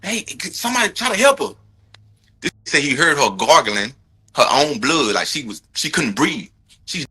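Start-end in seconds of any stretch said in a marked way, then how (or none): 0.0-1.5 high-pitched, desperate calling for help; mock frantic husband yelling for help; imitating a frantic plea. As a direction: act out a panicked husband begging for help. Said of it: "He didn't say".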